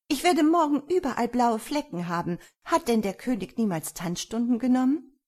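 high, loud furious daughter; angry. A narrator's reading. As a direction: complain crossly to your father. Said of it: "sagte die Herzogstochter wütend".

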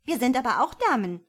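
put-on high, loud little-girl voice; die Kleine. A narrator's reading. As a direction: pipe up as the youngest sister, insistent. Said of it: "sagte die Kleine". (hohe, laute Kinderstimme)

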